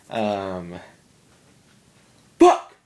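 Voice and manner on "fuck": high, loud annoyed shout; frustrated yell. Real, not acted. Vlogger to girlfriend, yawning again, loud high yell.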